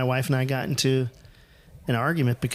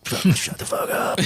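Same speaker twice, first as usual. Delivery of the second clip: strained, higher, half-voiced mock outburst; comic exasperation, voice squeezed upward; mock exasperated retort; 6 semitones higher than usual. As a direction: act out a fed-up retort under strained breath. Acted exasperated retort to his wife.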